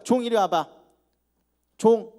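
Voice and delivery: loud call across the house, rising high; sharp shouted call for the servant. Acted. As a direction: summon the servant loudly. Master calling the servant.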